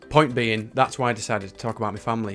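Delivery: normal talking voice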